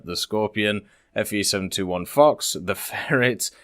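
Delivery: normal talking voice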